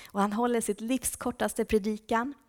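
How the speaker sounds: ordinary talk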